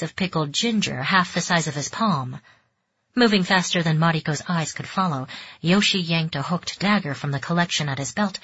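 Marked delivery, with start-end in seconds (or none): none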